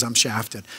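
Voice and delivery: a hint of the stranger sighing it out; glum quote. Stranger wraps up his story to pastor, low dejected murmur. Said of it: "So he goes".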